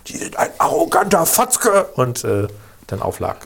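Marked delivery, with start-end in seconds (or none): 0.1-1.8 high, drawn-out mock outrage; mock indignant caller. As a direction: imitate an indignant caller, slow and rising in pitch. Retold insult ending a phone dispute.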